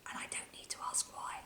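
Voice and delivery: fast breathy whisper to the audience; whispered aside. Acted. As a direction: let them in on it in a whisper.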